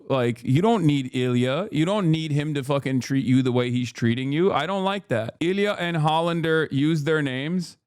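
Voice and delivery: ordinary talk, nothing marked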